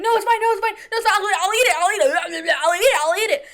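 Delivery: high, loud, rushed reassurance; frantic pleading. Retold or imitated. Sister calming the angry customer, high frantic. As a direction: beg him to stop, high and loud. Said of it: "my sister was like".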